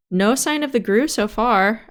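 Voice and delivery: ordinary talk, nothing marked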